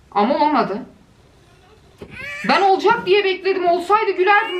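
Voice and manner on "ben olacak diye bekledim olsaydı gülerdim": mock outburst. Real, not acted. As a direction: protest playfully. Mock indignant at video.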